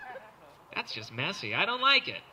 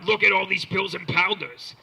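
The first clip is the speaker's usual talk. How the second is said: louder show-off dealer voice; bragging dealer; 2 semitones higher, 7 dB louder than usual. Retold or imitated. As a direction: show off the stash. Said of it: "And then, like, the".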